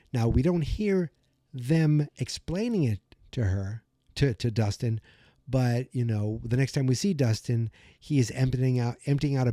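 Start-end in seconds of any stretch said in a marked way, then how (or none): none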